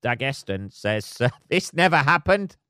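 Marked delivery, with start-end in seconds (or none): 1.5-2.6 mock official denial, voice up high. Comedian mocking the Dagestan ministry, mock official voice. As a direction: parody a stiff state spokesman.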